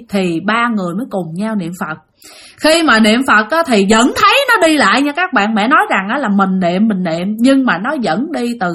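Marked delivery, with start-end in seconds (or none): none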